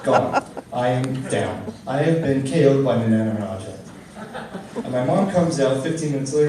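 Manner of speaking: ordinary delivery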